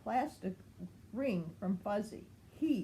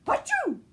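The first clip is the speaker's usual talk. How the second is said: loud high sneeze; 10 dB louder than usual. Acted. Chase sniffing a feather, a loud high achoo. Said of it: "Chase sneezed".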